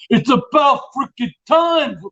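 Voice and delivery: high, slow mock New York holler; John, loud and abrasive. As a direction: bellow it like an impatient wiseguy diner. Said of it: "he's, he's so loud".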